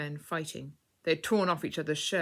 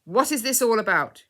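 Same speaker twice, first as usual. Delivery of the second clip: firm question; 7 semitones higher, 11 dB louder than usual. A narrator's reading. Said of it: "in a quieter but still firm way".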